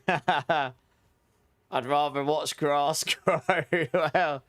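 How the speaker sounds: drawn out through laughter; cracking up while talking; laughing. Real, not acted. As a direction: laugh your way slowly through it. Cracking up at cricket joke.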